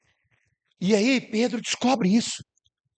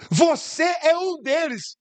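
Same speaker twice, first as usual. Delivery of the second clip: slow accusation; 8 semitones higher, much slower than usual. Acted.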